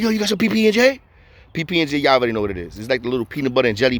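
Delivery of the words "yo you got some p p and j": high, put-on neighbor voice; neighbor's voice. The neighbor asking him for peanut butter packs, high and pushy.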